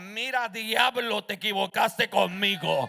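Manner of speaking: slow, loud commanding shout; rebuking the devil. Real, not acted. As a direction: cast him out with full authority. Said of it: "Cuando el diablo a mí me dice". (reprendiendo al diablo a gritos, lento y firme)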